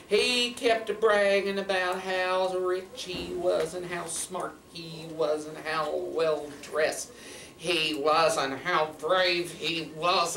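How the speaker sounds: slow, drawn-out tale telling; storytelling. A narrator's reading. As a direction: stretch the words like a fireside yarn. Tells the tale.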